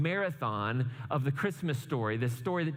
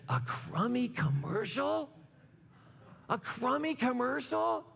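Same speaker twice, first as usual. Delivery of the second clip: put-on high, rising disbelief of a let-down boy; mock incredulous; 9 semitones higher, slower than usual. Boy disappointed by the decoded message, acted incredulity. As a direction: draw it out as an indignant question.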